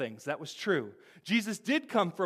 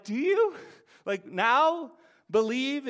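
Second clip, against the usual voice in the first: high, drawn-out doubting question; skeptical Jesus; 7 semitones higher, 4 dB louder, slower than usual. Retold or imitated. Preacher voicing Jesus, skeptical and slow toward his disciples. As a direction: let the doubt hang on now, slow and high. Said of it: "And Jesus is like".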